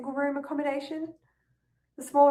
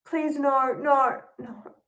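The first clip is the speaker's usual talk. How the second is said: louder pleading denial; 3 semitones higher, 5 dB louder, much slower than usual. Acted. Discovering laptop stolen.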